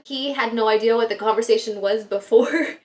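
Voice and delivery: normal talking voice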